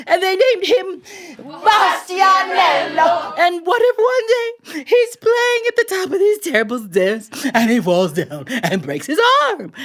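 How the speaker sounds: high, wailing lament, voice rising and straining; wife wailing. A narrator's reading. Wife weeping to her husband about an unborn son, high wailing. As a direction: sob out the whole imagined disaster. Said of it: "And, and his wife said".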